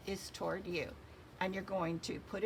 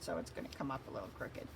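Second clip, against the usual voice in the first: muttered aside; 7 semitones lower, 6 dB quieter, faster than usual. Real, not acted. Muttered aside about own mistake.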